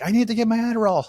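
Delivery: high, fast, urgent blurt; desperate craving. Retold or imitated. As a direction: beg for it like an addict. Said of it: "I was like".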